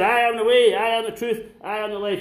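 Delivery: high, loud, ringing proclamation; proclaiming loudly. Acted. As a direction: declare it like a herald to a crowd. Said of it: "Jesus said".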